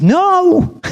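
put-on high, loud child's no; a scared kid flatly refusing; high yelped refusal; frightened little boy.

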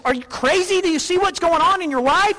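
high, loud "are you crazy" from people watching; incredulous onlookers. Retold or imitated. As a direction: play the doubters who think you've lost your mind. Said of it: "and they say".

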